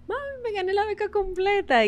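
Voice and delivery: excited news. Retold or imitated. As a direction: tell mom the great news, thrilled. Noticia de la beca a mamá. (gritándole a su mamá la buena noticia, emocionada)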